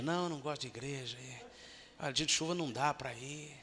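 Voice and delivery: soft, fast whiny excuse; lazy churchgoer dodging service; mock excuse. Mocking excuse of absent members.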